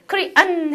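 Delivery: Korean with a drawn-out closed n ending; 영어식 끝소리. Shown as a demonstration. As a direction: slowly land on the final n of Korean. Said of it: "해서 은 발음해요". ("Korean"의 끝 "은"을 닫아서 길게)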